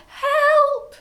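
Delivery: very high, loud shriek; cry for help. A narrator's reading. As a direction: scream for rescue in terror. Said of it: "the other one cried".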